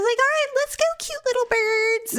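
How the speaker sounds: high sweet-talking cute little birds; mocking husband. Mimicking Daryl calmly calling the chickens, high cooing. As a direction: coo it like coaxing pets. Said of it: "you just went out there, like".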